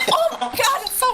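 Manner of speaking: shocked. Real, not acted. Disgusted reaction to opened jar.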